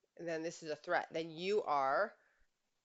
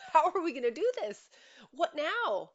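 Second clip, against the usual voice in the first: higher and louder, at wit's end; desperate exclamation; 10 semitones higher, 8 dB louder than usual. Real, not acted. Coach imagining a wife with no way forward, desperate high exclamation. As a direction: plead it up high, half laughing. Venting frustration in relationship.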